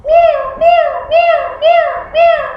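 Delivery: high, squeaky meows; cat meow. Shown as a demonstration. Teacher showing children a cat's sound, high meowing. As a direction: mew like a little cat, up high. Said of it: "a cat making a sound".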